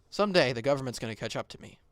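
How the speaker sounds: normal talking voice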